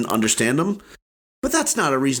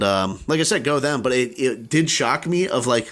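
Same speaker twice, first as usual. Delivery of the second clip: normal talking voice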